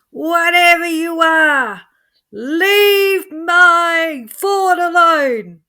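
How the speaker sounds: very high, loud, drawn-out scream. Acted. Joe at the wheel screaming at the alien light. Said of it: "and he was screaming".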